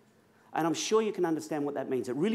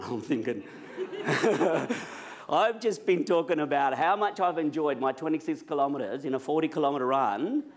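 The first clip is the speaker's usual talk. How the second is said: ordinary delivery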